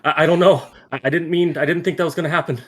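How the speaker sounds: high, loud, stammering protest; panicked denial. Acted. Noah reacts to magic going wrong.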